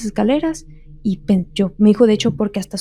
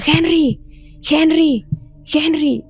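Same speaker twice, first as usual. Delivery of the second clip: a mock shout that builds on each Henry; retold calling out; 7 semitones higher, much slower than usual. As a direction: call Henry's name like someone shouting from another room. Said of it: "cómo me gritaban".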